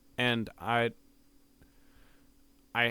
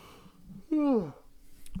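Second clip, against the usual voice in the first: high, sleepy yawn sigh. Real, not acted. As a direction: let out a tired yawn. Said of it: "sorry, I'm yawny right now".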